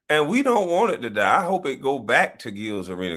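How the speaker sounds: ordinary talk, nothing marked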